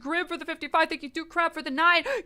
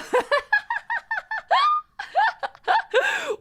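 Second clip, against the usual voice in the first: high shrieking laugh; shrieking laughter. Real, not acted. Laughing at own joke.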